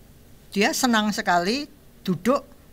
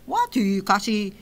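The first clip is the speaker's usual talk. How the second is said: normal talking voice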